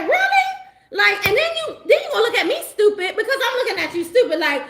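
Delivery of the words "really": mock disbelief. Mocking the ex's reaction.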